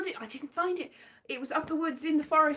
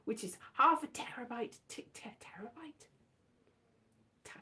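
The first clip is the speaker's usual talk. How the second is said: whispering; 8 dB quieter than usual. Real, not acted. Unsure whisper about terabyte.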